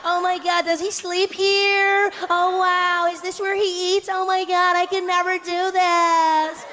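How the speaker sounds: very high, slow, gushing tourist voices. Retold or imitated. Tour group gushes over a stranger's home, high and slow. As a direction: coo over everything like clueless tourists. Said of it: "people in our tour group were like".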